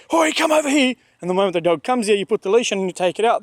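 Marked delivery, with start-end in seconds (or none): 0.1-1.0 yelling at the dog. Retold or imitated. Frustrated owner yelling at dog across the park, high call. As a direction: yell the dog back, much higher. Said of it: "you're yelling".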